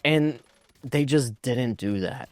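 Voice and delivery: usual speaking voice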